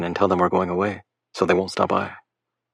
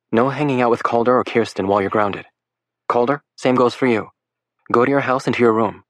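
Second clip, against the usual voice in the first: faked motherly tone; 4 semitones higher than usual. Lake scolding the boys as a pretend parent, lightly stern mother. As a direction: lecture them like a firm mom. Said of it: "superbly faked motherly tone".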